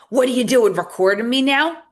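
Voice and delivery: loud, high outraged yell; angry shout. Retold or imitated. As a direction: shout it, caught out. Abuser caught on video, retold.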